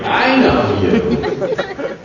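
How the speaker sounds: laughing tease. Retold or imitated. Mock-accusing producer, laughing.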